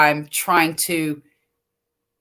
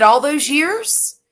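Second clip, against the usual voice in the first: exasperated disbelief; 8 semitones higher, 5 dB louder, slower than usual. Retold or imitated. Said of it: "And I said".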